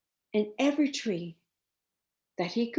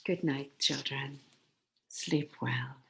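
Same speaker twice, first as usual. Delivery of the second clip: soft goodnight; 6 semitones lower, 7 dB quieter than usual. Real, not acted. Bedtime sign-off to children.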